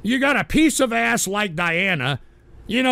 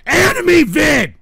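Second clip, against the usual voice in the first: high, loud, slow burst of frustration; pissed-off shout; 7 semitones higher, 8 dB louder than usual. Real, not acted. Streamer stumbling over his own words, frustrated shout. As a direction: force the words out in exasperation. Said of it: "you got me so pissed off".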